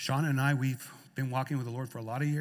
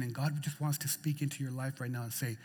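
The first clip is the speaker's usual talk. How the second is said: soft, lowered, consoling voice; tender reassurance; 3 semitones lower, 8 dB quieter than usual. Acted. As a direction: speak gently to someone who is tired. Consoling weary believers with God's words.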